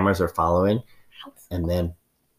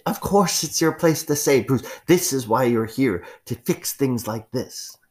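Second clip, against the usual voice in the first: insistent raised voice; 7 semitones higher, slower than usual. Acted. Ma'am insists loudly.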